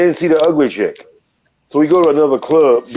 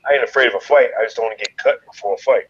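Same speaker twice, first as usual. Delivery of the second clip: usual speaking voice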